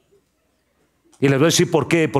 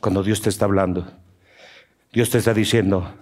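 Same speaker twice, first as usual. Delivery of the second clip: ordinary talk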